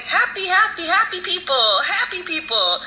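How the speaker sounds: high, singsong retelling of grandfather; imitating grandfather. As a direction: chant it lightly in a high, put-on voice. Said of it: "He just kept saying".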